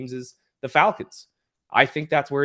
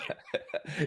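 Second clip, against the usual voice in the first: quick breathy laugh; a brief real laugh at the joke; short laugh; 10 dB quieter than usual. Real, not acted. Laughing at host's flu joke.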